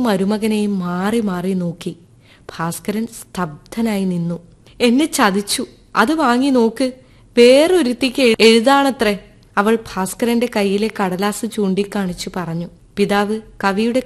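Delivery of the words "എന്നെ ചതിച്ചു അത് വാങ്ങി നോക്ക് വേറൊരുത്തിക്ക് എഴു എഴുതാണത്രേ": raised, louder, higher accusing cry; distraught accusation. A narrator's reading. Ammu accuses husband before her father, distraught and loud. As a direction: hurl the blame at him through tears.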